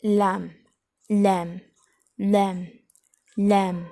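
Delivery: लैम said loud, ending on a clean m; lamb with silent b. Shown as a demonstration. Teacher repeating lamb for students, loud and clear. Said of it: "silent करेंगे बोलते वक्त".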